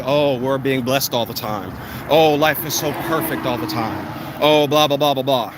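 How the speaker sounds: low, droning mock of fake positivity; mocking imitation. Mimics fake blessed social media pages.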